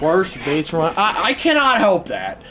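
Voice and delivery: ordinary talk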